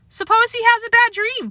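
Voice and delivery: put-on high child voice, worried; little boy Fudge. A narrator's reading. Fudge asking Mom about bad dreams, high child voice. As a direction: voice the small boy fretting.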